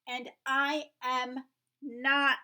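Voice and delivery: loud, slow emphatic protest. Acted. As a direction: punch it out in big letters. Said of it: "wrote it in big letters".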